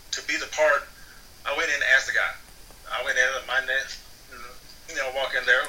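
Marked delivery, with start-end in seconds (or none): none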